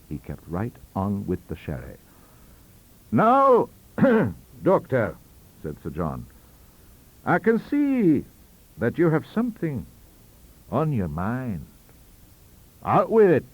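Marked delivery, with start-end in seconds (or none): 3.1-5.2 put-on high, hearty old statesman, raised voice; Sir John's voice. Sir John addresses the doctor. 7.3-13.4 put-on high, knowing, loud old statesman urging him on; Sir John coaxing. Sir John presses the doctor.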